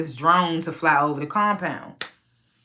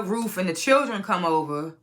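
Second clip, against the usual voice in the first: ordinary talk, nothing marked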